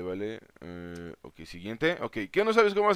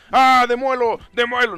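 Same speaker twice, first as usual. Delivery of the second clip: loud, high excited yell; worked-up outburst; 8 semitones higher, 8 dB louder than usual. Real, not acted. Gamer told he must demolish things, worked-up shout. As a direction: burst out, carried away. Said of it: "perdón, me alteré".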